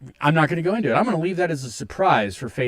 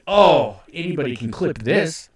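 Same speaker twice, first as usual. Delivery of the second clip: ordinary talk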